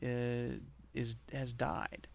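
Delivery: quietly mock-somber announcement; fake somber. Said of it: "come into a room and go".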